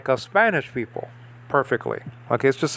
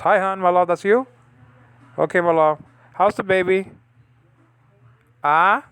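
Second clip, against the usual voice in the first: higher, brighter tone for his wife; sweet phone greeting; 5 semitones higher than usual. Real, not acted. Teacher answering a call from his wife, tender. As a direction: greet her lovingly on the phone.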